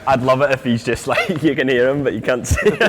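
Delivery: giggling through the words, real laughter; laughing. Real, not acted. Co-host laughing about the guest being heard but not seen. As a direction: laugh as you picture it.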